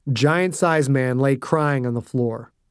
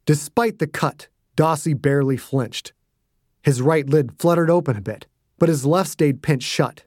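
plain reading voice

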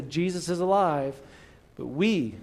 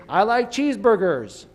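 loud, high, slow American caricature; exaggerated American accent, drawn out; hammed-up American; 6 semitones higher, 6 dB louder than usual. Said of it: "what else would make me sound American".

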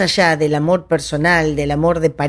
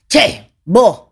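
short, louder and higher call; imitated shout; 5 semitones higher, 6 dB louder than usual. Shown as a demonstration. Someone shouting to get another's attention, demonstrated call. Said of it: "cuando uno le grita".

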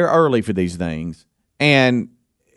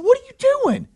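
incredulous. Retold or imitated. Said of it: "I said, I said".

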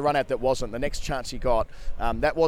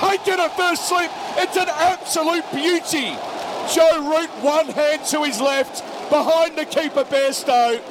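excited live play-by-play, pitched high; cricket commentator; 12 semitones higher, 4 dB louder, slower than usual. Real, not acted. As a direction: call the wicket as it happens. Live wicket call, highlights.

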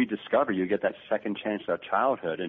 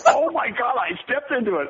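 laughing disbelief; 13 semitones higher, 4 dB louder than usual. Retold or imitated. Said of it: "he's like".

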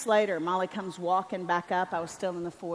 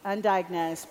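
usual speaking voice

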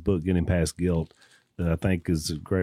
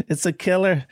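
Irish brogue; 10 semitones higher than usual. Retold or imitated. Host quoting a fellow preacher in a high mock Irish brogue. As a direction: imitate Jim McGuigan's Irish brogue. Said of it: "With that Irish brogue".

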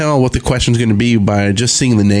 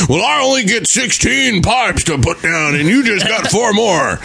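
higher, slow whiny mock of Wes arguing; imitating Wes; 9 semitones higher than usual. Teammate mocks Wes complaining about his pipes, whiny. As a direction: play Wes as a put-upon whiner. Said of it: "Wes is all like".

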